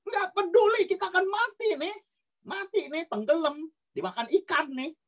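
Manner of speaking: very high, acted panic of drowning disciples; hammed-up high-pitched alarm; mock panicked yelling. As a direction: wail in a high voice as if the boat is sinking. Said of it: "itu pasti udah teriak-teriak".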